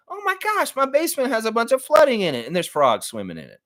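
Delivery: high, shrill mock panic over a flooded basement; panicked homeowner. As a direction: squeal it like a frantic homeowner. Panicked customer in the ad.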